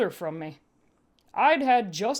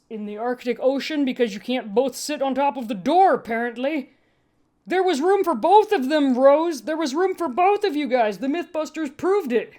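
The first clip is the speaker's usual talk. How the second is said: higher, louder indignant rant; louder, higher exasperated rant; ranting aside; 6 semitones higher, 6 dB louder than usual. Real, not acted. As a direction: vent about the Titanic door. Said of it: "ranting aside about Titanic".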